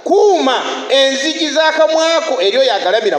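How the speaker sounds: high, loud preaching warning; forceful warning. Real, not acted. Preacher warns the church to guard their mouths, loud and high. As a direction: thunder the warning at the congregation.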